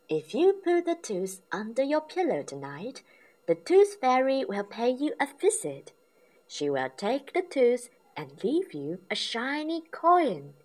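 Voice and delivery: kindly mother telling a bedtime promise; Mummy Pig explaining. A narrator's reading. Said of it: "said Mummy Pig".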